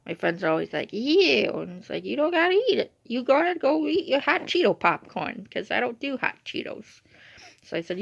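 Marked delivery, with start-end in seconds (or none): 0.9-1.5 high, loud grossed-out squeal; exaggerated, raised cry of revulsion; mock disgust. Acting out disgusted friends. 2.1-7.0 high-pitched, mock-indignant retort; raised, defensive acted sass; sassy comeback. Retelling sassy comeback to friends.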